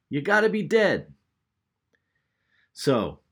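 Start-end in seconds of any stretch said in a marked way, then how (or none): 0.1-1.1 put-on loud, high-pitched kid's shout; kid yelling out. One kid who got up his nerve, yelling out. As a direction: blurt it out high and loud, like a child. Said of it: "he yelled out".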